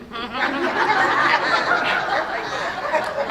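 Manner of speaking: a real burst of laughter at her own joke. Real, not acted. Laughing at her own t-shirt joke, a real burst of laughter.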